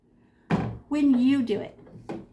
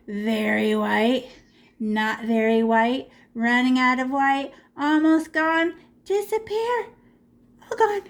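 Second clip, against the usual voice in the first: sing-song countdown. Shown as a demonstration. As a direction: step the pitch up as the paint vanishes. Chanting as paint fades away.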